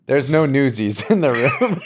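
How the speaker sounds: slow delivery breaking into laughter; laughing. Real, not acted. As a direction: say it through a laugh. Laughing at own bit.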